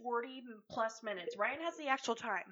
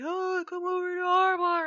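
lightly acted bouncer's call, raised and higher; 7 semitones higher, 8 dB louder than usual. Bouncer luring passers-by into his bar, mock calling out. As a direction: call out an invitation, imitating a bar tout.

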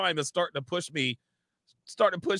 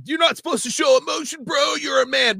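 loud, high mock tough-guy shout; mock macho shout; 10 semitones higher, 5 dB louder than usual. Scolding a crying man, mock tough-guy shout. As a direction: bark it like a macho bro. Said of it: "I'm not the guy who's gonna be like".

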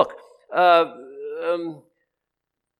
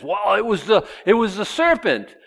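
higher, louder it was the serpent; blame shifting; 8 semitones higher, 3 dB louder than usual. Retold or imitated. Eve shifting blame to the serpent, high and loud. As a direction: point the finger at the serpent. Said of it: "And so she says".

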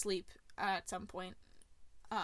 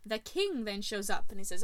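usual speaking voice

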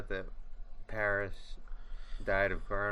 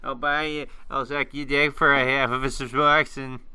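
loud and higher junkie bro; 4 semitones higher, 7 dB louder than usual. Pretend. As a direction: yell it like a desperate bro.

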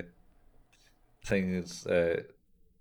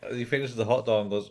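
normal talking voice

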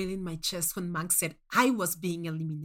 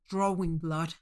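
ordinary delivery, nothing marked

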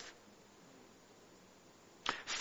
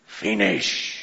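solemn Christ's words; 3 semitones higher, much slower than usual. Retold or imitated. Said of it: "He said".

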